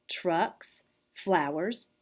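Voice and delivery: ordinary delivery, nothing marked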